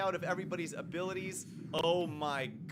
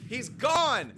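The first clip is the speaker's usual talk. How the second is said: excited; 7 semitones higher, 8 dB louder than usual. Real, not acted. Boss knocked out mid-fight.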